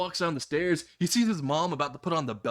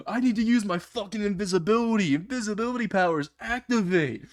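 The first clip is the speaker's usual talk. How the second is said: high, mildly raised panic yell; mock scream, pitched up in desperation; panicked scream; 6 semitones higher than usual. A narrator's reading. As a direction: cry it out high, as if chased. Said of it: "he starts screaming".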